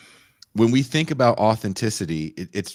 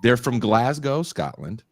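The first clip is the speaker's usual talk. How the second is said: ordinary talk, nothing marked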